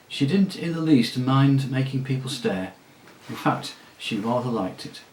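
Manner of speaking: usual speaking voice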